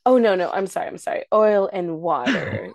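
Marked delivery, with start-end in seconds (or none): 1.3-2.7 slow, measured mock delivery; slow talking. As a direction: imitate someone spacing out each word. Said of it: "you're talking slow".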